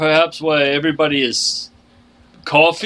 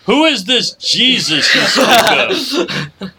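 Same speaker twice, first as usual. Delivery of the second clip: naive stranger voice; 6 semitones higher, slower than usual. Acted. Player character plays naive.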